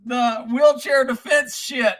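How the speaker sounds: laughing. Real, not acted. Laughing while reading a book title.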